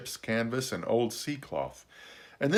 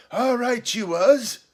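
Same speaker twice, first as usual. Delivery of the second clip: high, louder, slow castaway exclamation; excited cry; 13 semitones higher, 7 dB louder, slower than usual. A narrator's reading. Castaway agreeing with the boy, a high excited cry. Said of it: "he cried".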